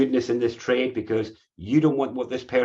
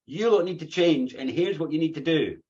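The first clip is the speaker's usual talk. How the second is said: high-pitched mock crusader rant; higher, insistent caricature of a campaigner; 6 semitones higher than usual. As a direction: demand change like a zealous crusader. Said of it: "the Crusader's like".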